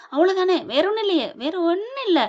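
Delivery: acted cover-up called through a door; hasty reassurance. Covering up to relative outside door.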